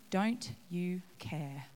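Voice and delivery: "Don't you care?" whispered slowly; whispered echo. Acted. Martha repeating her complaint, whispered slowly. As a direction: let it fade to a hushed whisper.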